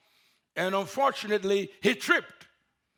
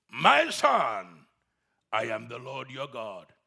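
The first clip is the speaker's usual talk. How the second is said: lower, solemn voice of God; 10 semitones lower, slower than usual. Acted. God answers the climber in a low solemn voice. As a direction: speak as the Lord, calm and deep.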